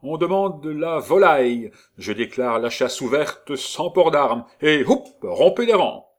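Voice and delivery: loud barked orders; commanding shout. Acted. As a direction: command the troops loudly. Officer commanding the hunt.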